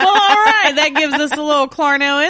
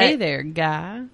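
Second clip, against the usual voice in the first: low, slow teasing hello; mocking greeting; 7 semitones lower, slower than usual. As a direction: drawl it teasingly.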